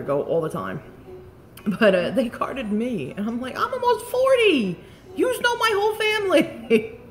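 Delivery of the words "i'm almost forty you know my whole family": high-pitched indignant protest; mock outrage. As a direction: act out being affronted, voice way up. Acts out protest at being carded.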